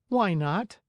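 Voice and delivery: put-on high boyish puppet voice; a lightly voiced young puppet; Pinocchio voice. A narrator's reading. Pinocchio asks.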